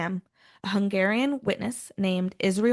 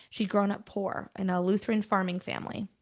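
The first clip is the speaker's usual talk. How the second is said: usual speaking voice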